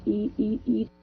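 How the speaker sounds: ordinary delivery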